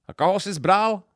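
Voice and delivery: high, brash taxi driver question; cabbie's voice. A narrator's reading. Taxi driver asking the groom whom he married, high. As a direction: tease him about his bride. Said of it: "řekl taxikář".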